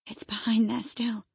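frightened, breathless whisper; anxious whisper. Acted. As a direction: whisper it as if the thing might hear you. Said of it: "she pursued anxiously".

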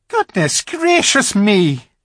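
high, slow gasp of surprise as the faun; a startled faun cries out, drawn out high; startled exclamation. A narrator's reading. Faun startled by Lucy, high slow exclamation. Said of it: "exclaimed the fawn".

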